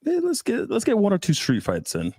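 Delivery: ordinary talk, nothing marked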